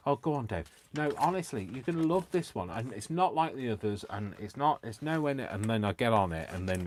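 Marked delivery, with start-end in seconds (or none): 0.1-5.5 high-pitched, eager persuading patter; imitated friends talking him into it; coaxing friends. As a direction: wheedle him in a higher voice. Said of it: "where people have said".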